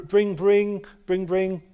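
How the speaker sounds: imitated phone ring. Host starting a fake phone call to his friend, imitated ringing. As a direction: play the telephone ringing.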